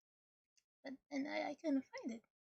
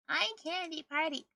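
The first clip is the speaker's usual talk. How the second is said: loud; 10 dB louder than usual. Acted. Repeats game command louder.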